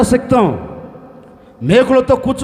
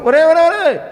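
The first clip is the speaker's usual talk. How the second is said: mocking call; 6 semitones higher, 4 dB louder than usual. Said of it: "హేళనగా మాట్లాడుతున్నాడు".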